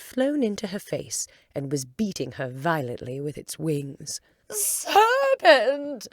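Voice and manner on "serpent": much higher, much louder accusing cry; a pigeon screeching in fury; shrieking pigeon. Acted. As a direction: scream the accusation. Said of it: "cried the pigeon".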